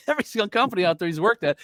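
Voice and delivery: words squeezed out through a laugh, a bit fast; laughing while listing his jobs. Real, not acted. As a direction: chuckle through the line. Laughing at his own joke.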